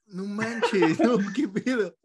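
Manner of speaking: loud laughing disbelief. Real, not acted. Listener reacts to cajeta punchline. (incredulidad entre risas, más fuerte)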